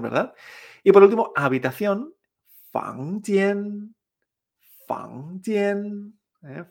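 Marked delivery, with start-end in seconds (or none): 2.7-6.2 "fangjian" climbing to a sustained high note; repeat-after-me "fangjian", drawn out; tones two-one. Shown as a demonstration. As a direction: lift "fang", hold "jian" high and level. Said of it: "es la misma entonación".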